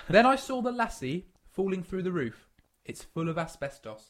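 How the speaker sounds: put-on careful, understandable English accent; slowly translate it into plain English speech; English-accented version for outsiders; English accent translation. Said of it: "translated for our English demographic".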